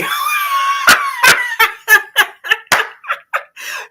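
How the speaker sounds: long, very high squealing laugh; helpless shrieking laughter; cracking up. Real, not acted. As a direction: burst into loud, high-pitched laughter. Cracked up by viewers' comments.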